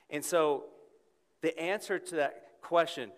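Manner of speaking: loud, high preaching climax; full-force preaching. Acted. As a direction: preach it out to the whole room.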